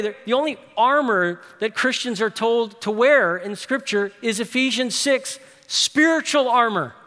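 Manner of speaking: rally the church to pray; soaring preacher's build; full-force preaching. Acted.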